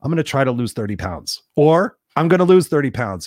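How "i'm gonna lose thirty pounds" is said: louder, higher, forceful declaration; punchy, emphatic resolve; firm commitment. Shown as a demonstration. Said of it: "See how that feels the next time".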